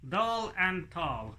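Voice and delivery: Doll and tall said slowly without moving the lips; lips-still ventriloquist demo. Shown as a demonstration. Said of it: "say the word to demonstrate".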